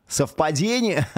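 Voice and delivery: high, drawn-out rhetorical question; mock-suspicious question. Host joking about a song's timing, mock-suspicious. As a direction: ask it slowly and high, teasing before a laugh.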